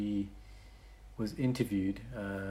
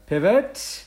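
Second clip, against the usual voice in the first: drawn-out loud, high-rising call; mock yell; 11 semitones higher, 9 dB louder than usual. Podcaster retelling a change of dinner plans, mock yell. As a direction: stretch the word out in a playful yell. Echoes the word as comic shout.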